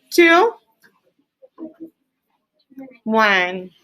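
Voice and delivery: ordinary delivery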